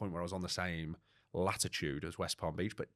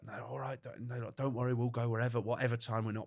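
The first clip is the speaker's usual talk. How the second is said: mum reassuring; 5 semitones higher than usual. Retold or imitated.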